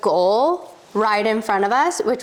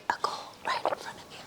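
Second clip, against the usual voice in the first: whispering; 15 dB quieter, much faster than usual. Real, not acted. Awed hush about Messi.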